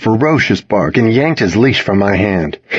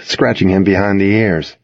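usual speaking voice

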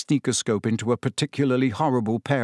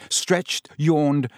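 ordinary delivery

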